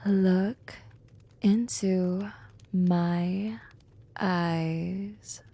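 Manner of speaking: "Look into my eyes" one word at a time, each drawn out and low; deep, deliberate command with a pause after each word of "Look into my eyes"; commanding hypnosis. Acted. Final hypnotic command, repeated word by word.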